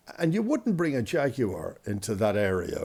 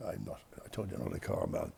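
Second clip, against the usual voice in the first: muttered aside; 3 semitones lower, 12 dB quieter, faster than usual. Real, not acted. Muttered aside repeating himself.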